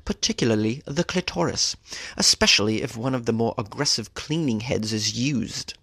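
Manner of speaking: a lightly put-on British accent, savoring "Particularly the clitoris". Host repeats the gynecologist's phrase for fun in a British accent. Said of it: "I want to say that again".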